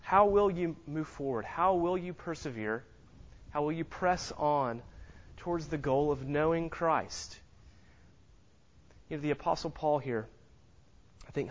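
ordinary delivery

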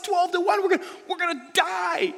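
high, loud panicked cry; panicked shout. Retold or imitated. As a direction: yell it like certain doom is coming. Gideon panics at God's plan.

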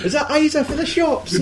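very high put-on voice, laughing; laughing mock voice. Joking caller on the phone, very high laughing mock voice. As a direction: squeak it out cracking up.

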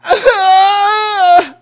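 loud, high, long mock wail; imitated sobbing wail. Preacher imitating a man sobbing at lunch, loud mock wail. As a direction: imitate a man bawling, not authentic. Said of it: "he's going".

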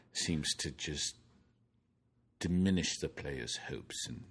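portentous British golf commentator, slow; ringing opening sinking into a hushed, drawn-out close; Henry Longhurst. Acted. Golf commentator introducing the sixteenth hole, portentous impression. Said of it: "Henry Longhurst here".

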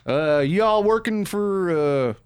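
slow, high Southern gentleman drawl; a Southern gentleman's drawl, slow and high. Retold or imitated. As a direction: drawl it like a Southern parking guard. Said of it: "He wasn't a Southern gentleman?".

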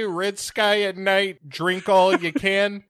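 put-on a drunk pirate with a singsong saying; high, slow pirate saying. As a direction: lift it up and stretch it slowly.

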